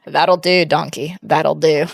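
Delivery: a hint of ogre impression; Shrek. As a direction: quote it low, like the cartoon ogre. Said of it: "when I watch Shrek".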